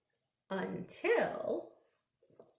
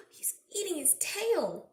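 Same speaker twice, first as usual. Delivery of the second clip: surprised gasp; 7 semitones higher than usual. Real, not acted. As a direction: gasp at the picture, amazed. Gasps at picture.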